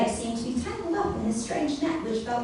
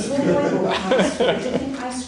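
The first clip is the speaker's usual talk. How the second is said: laughing; 3 semitones higher, 8 dB louder, slower than usual. Real, not acted. Narrator giggles through line.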